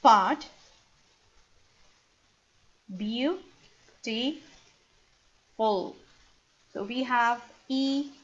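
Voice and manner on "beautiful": Beautiful drawn out softly as a model; beautiful said slowly. Shown as a demonstration. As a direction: pronounce Beautiful slowly for the class. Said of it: "let's divide beautiful into part".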